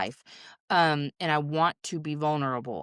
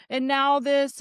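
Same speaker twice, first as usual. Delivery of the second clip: high, louder mock-panicked inner voice; panicky thought; 10 semitones higher, 5 dB louder, slower than usual. Grieving parent voicing racing thoughts, mock panicky. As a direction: imitate a panicky thought, pitched up. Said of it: "all the panicky shit of".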